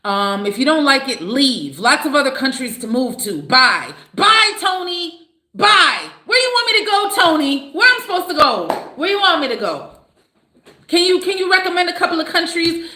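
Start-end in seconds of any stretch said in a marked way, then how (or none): 4.1-6.0 high, drawn-out mocking goodbye; mocking bye. Real, not acted. Waving off a commenter who told her to leave, mocking. 6.3-12.9 raised, incredulous questioning; indignant challenge. Real, not acted. Challenging Tony, who told her to leave, indignant and raised.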